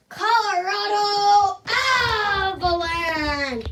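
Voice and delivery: drawn-out arena announcer call; announcer intro. Pretend. Kid announcing the away team, slow arena-announcer call. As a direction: stretch the team name like a PA announcer.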